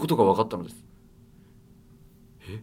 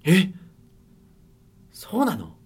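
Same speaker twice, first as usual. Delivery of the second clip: mock surprise; 7 semitones higher, slower than usual. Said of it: "とはなんない".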